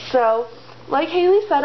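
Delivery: ordinary delivery, nothing marked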